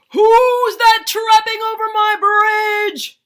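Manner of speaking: huge high-pitched bellow; enormous shouted troll challenge; full troll roar. A narrator's reading. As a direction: roar at the top of your voice as a troll. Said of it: "roared the troll".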